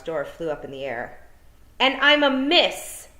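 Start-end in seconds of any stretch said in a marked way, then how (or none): 1.8-3.1 loud, high, slow indignant shout. A narrator's reading. Tiffany yelling after the vanished men, indignant shout. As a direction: shout it after them, offended. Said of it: "shouted Tiffany".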